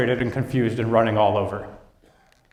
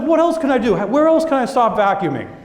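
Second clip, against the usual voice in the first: hungry for the next fix, pitched up; excited employee wanting more; eager employee; 6 semitones higher than usual. Retold or imitated. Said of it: "No, he's like".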